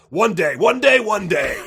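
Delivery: booming, echoing arena hype; monster truck announcer. Pretend. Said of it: "the monster truck rally announcer voice".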